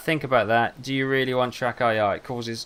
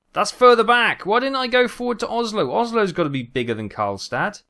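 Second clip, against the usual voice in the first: much higher, louder indignant complaint; 10 semitones higher, 6 dB louder than usual. Real, not acted. Driver complaining about being towed to Karlstad, indignant. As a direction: protest the wrong destination. Annoyed at wrong destination.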